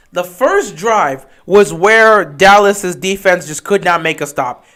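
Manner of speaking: ordinary delivery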